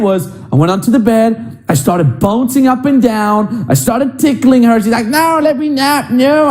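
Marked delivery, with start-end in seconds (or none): 5.1-6.5 mock girlfriend protest. Girlfriend resisting tickling.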